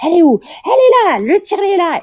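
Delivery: high, loud excited cry; mock shout of staff spotting the pump; shouted discovery. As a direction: call out as if catching a thief. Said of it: "j'entends genre".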